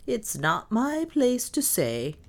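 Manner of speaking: slow, doleful holding back; grim reticence. A narrator's reading. Maid hinting darkly to her mistress, grim and doleful. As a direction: drag it out with grim reluctance. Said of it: "said with a grim shake".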